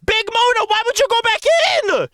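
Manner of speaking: high-pitched, exasperated shouting at the fighter; frantic yelling. Real, not acted. Frantic pleading as fighter goes back in.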